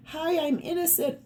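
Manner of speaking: high, mock-innocent chirp; innocent figure. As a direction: squeak it out like butter wouldn't melt. Voicing the innocent-looking card figure.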